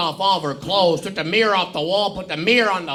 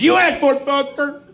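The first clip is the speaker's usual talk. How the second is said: exasperated challenge; 6 semitones higher than usual. Acted. A fed-up teacher daring the rude student to go. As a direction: dare the kid to try it.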